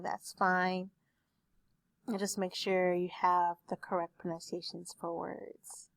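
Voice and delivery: ordinary talk, nothing marked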